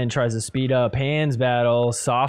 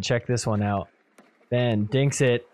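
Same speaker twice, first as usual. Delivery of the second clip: ordinary delivery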